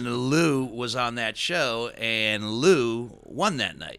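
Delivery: slow, loud, weighty announcement; dramatic reveal. Real, not acted. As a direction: land it slowly like a big reveal. Dramatic reveal of Lou winning.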